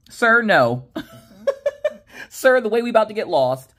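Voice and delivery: high, loud, exasperated; sir, no, with disbelief; exasperated exclaim. Real, not acted. Exclaiming at husband's bad idea.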